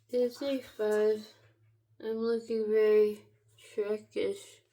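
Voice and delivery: usual speaking voice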